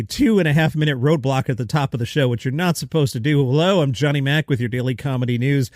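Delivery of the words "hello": ordinary talk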